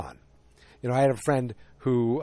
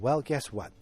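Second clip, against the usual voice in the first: ordinary delivery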